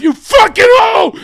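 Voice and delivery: high, loud acted scream of abuse; angry yelling. Husband at home to his wife, angry yelling. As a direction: yell it full out in mock fury. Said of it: "yelling at his wife".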